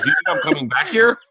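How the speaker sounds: high-pitched indignant question; mock incredulity. Diner answering free meal offer, hammed-up incredulous high voice. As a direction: throw the voice way up in disbelief. Acted complaint to the waiter.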